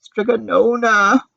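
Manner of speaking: high, slow weeping call. A narrator's reading. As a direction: wail the name in grief. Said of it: "wept Antonia".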